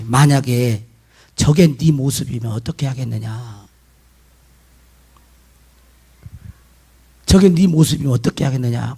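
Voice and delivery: low, grave questioning; solemn inner voice. Retold or imitated. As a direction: pose it low as a sobering question from within. Voicing the thought that came to him. (낮고 엄숙하게 묻는 내면의 음성)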